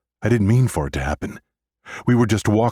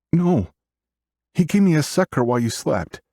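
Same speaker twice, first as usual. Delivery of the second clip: put-on high, small child's voice; little sister; 11 semitones higher than usual. A narrator's reading. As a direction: answer as a seven-year-old girl, pitched way up. Little sister answers brother.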